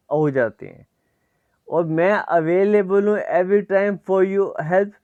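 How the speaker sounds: ordinary talk, nothing marked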